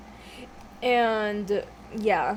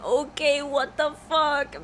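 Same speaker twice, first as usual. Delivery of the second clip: put-on higher, drawn-out kid's yell; mock-yell like an overexcited kid; imitated yelling; 5 semitones higher, slower than usual. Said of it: "he starts yelling".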